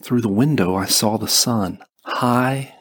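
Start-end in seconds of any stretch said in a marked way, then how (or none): none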